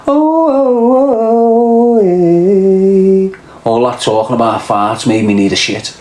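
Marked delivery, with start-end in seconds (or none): 0.1-3.3 high, loud strained groaning; pained urgent groans. Real, not acted. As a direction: groan like you urgently need the loo. Sudden urge to shit.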